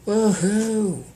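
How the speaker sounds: loud high cheer of joy; excited whoop. A narrator's reading. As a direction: whoop it out as he lands. Prince swoops in cheering.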